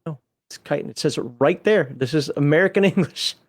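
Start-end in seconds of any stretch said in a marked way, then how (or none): 0.7-0.9 chitin said KITE-in; corrected pronunciation. Shown as a demonstration. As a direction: insist on chitin with a hard K. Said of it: "No, it isn't".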